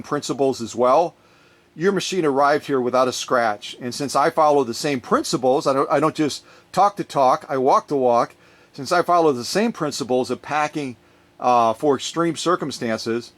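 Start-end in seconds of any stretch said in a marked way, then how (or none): none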